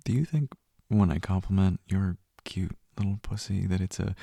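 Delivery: ordinary talk, nothing marked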